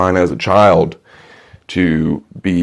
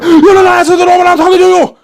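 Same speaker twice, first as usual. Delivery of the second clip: furious German shout; 5 dB louder, faster than usual. Acted. Salesman erupts at customer.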